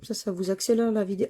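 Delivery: ordinary delivery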